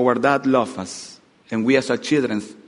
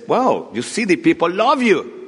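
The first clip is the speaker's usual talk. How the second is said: excited follower; 6 semitones higher, 4 dB louder than usual. Acted. Follower excitedly tells Napoleon.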